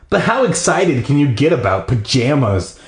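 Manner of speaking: plain reading voice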